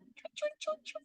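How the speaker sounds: tiny, high, soft chirps; chirping imitation. Shown as a demonstration. Teacher making a picture-book sound for listeners, high soft chirps. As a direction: chirp it lightly as a story sound effect.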